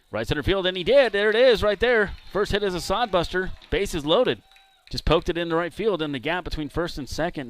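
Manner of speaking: loud, high burst of excitement, easing off at the end; excited hit call. Real, not acted. Announcer calling a batter's long-awaited first hit, loud and excited. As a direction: call the hit at full voice, then settle.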